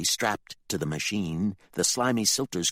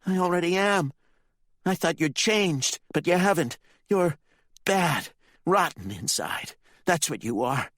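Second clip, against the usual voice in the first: much higher bitter accusation; hurt accusing boy; 11 semitones higher, 4 dB louder than usual. Acted. Ricky condemns his father, hurt. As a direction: spit out his disappointment.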